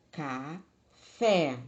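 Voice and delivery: café as ca... FÉ, the second beat strong; stress on final syllable. Shown as a demonstration. Teacher contrasting weak and strong syllables, café stressed on FÉ. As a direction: lean hard on the FÉ of café. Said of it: "A sílaba tônica é fé".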